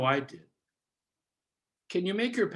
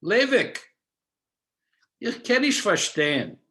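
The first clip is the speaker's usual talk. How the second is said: higher and louder, a puzzled elder calling Levik by name; family elder's query; 6 semitones higher, 5 dB louder than usual. Retold or imitated. His family questions young Levik, higher and louder. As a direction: voice a bewildered older relative. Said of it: "as my family would query".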